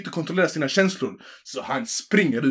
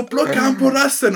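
put-on rowdy kids; 9 semitones higher, 4 dB louder than usual. Kids in the corridor hyping a fight, high mock jeer. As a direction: egg him on like a pack of twelve-year-olds.

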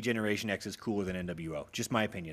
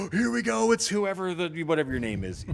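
hyped crowd; 7 semitones higher, 3 dB louder than usual. Retold or imitated. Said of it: "a reason for the crowd to go".

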